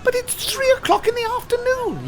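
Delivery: high, whiny called-out protest; children yelling. Acted. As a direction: shout it up at the window like a group of kids. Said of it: "yelled the children".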